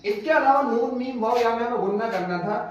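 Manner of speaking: ordinary talk, nothing marked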